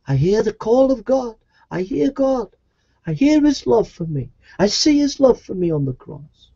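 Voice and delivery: high declaration of hearing God; calling back to God. Acted. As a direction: answer a far-off voice, pitched high. Acts out answering God's distant call.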